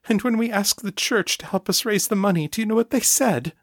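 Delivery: high, pleading mother telling her story; grieving mother, high voice. Acted. Mother explaining the past to her son, high grieving voice.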